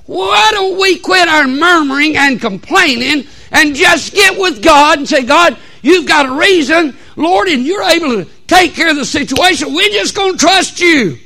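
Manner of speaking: high, loud pulpit shout; full-force preaching. Acted. Preacher urging church about trusting Christ, full-force preaching.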